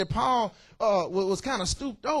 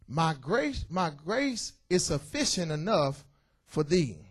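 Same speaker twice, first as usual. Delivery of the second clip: a touch softer and slower, lingering on grace; savoring the verse; 5 semitones lower, 5 dB quieter, slower than usual. Acted. Preacher repeating God's promise to the congregation, softly lingering. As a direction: let the verse sink in tenderly.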